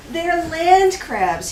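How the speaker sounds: slow, coaxing quote through a laugh; persuasive husband. Retold or imitated. Wife imitating her husband talking her into crab hunting, mildly coaxing. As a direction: sell the idea, laughing a little. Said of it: "he argues persuasively".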